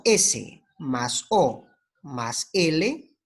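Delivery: very slow, each letter set apart; slow spelling. Shown as a demonstration. Teacher slowly building a syllable for students.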